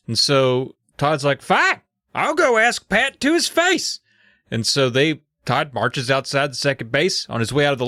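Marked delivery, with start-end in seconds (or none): 1.4-4.0 put-on high, slow defiant kid. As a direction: storm off like a kid. Todd decides to confront.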